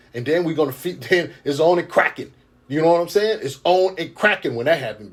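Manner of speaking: loud, fired-up preaching. Real, not acted. Preacher working himself up about the chosen, loud and fired up. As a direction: let it build into a shout of conviction. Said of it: "I'm getting fired up over here".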